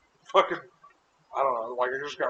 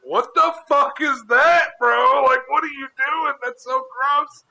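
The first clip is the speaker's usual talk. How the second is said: high, slow, loud revulsion; retold disgusted outcry; 10 semitones higher, 6 dB louder, much slower than usual. Son to Tyler holding mom's toy, loud mock disgust. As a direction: drag it out in appalled disbelief.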